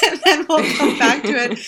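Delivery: high laughing burst; real laughter breaking out; laughing while still talking. Real, not acted. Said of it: "We're both laughing".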